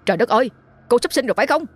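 fast, high alarmed cry; panicked. A narrator's reading. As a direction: blurt it out in a panic. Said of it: "Ông hoảng hốt". (hoảng hốt, nói nhanh)

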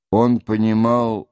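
ordinary delivery

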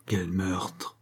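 hushed, breathy whisper; deep voice. Acted. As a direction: breathe it out in quiet dismay. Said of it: "d'une voix profonde".

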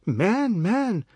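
high, drawn-out appeal; dismayed, wailing protest; pleading. A narrator's reading. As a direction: plead with an angry husband. Wife pleading amid quarrel.

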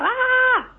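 very high short scream; woman's scream. Retold or imitated. Startled lady in the comic, short very high scream. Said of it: "un grito de una tía".

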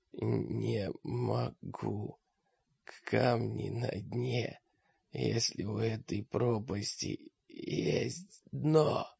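very soft, slow, broken off in pauses; despairing whisper. A narrator's reading. Defeated mage lies in the sand, despairing whisper. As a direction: whisper it slowly, all hope gone. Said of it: "Маг прошептал в глубоком отчаянии".

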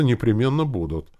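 joyful. A narrator's reading. Happy crowd promising to come tomorrow, joyful. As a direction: chime in like an eager crowd. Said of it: "Народ радостно загомонился".

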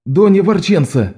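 desperate, pleading call; desperate cry. A narrator's reading. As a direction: cry out in despair. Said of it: "вскричал Лок в совершенном уже отчаянии". (в отчаянии)

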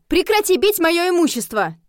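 high, loud scolding protest; loud, offended yell; indignant. A narrator's reading. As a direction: order them to stop, outraged. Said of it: "возмутилась я".

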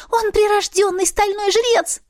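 very high insistent cat voice; a pushy little cat; cat spirit voice. A narrator's reading. Cat voice continues. (настойчиво, высокий кошачий голос)

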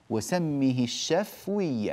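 الشفوي said with a silent fa, shafwi; slow, with the fa of الشفوي unvoweled; sukun on the fa. Shown as a demonstration. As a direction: slowly clip the vowel after the fa in الشفوي. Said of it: "هكذا بسكون الفاء في البيت".

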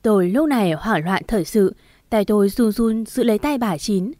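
ordinary delivery

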